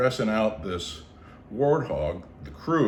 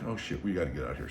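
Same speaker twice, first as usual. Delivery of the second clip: hushed panic; 7 semitones lower, 9 dB quieter, much faster than usual. Retold or imitated. Seeing ants pour into tent.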